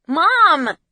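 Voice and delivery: high loud cry; shouting. A narrator's reading. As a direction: shout it in panic. Son answers in panic.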